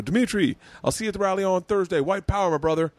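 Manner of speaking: high-pitched cheery holler to a friend; retold call-out. Calling back into the subway.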